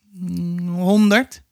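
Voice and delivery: put-on high, hesitant child's guess, drawn-out hum first. A narrator's reading. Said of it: "zei Momo aarzelend".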